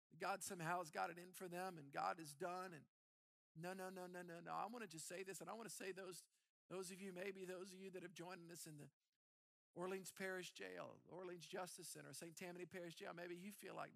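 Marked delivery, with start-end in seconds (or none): none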